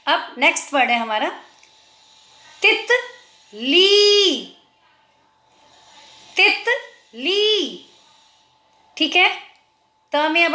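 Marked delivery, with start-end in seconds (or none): 2.6-4.4 "तितली" as short "तित", pause, high long "ली"; long final ee. Shown as a demonstration. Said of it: "ल में बड़ी आ रही है". 6.4-7.9 "तितली" as short "तित", pause, high long "ली"; long final ee. Shown as a demonstration. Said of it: "ल में बड़ी आ रही है".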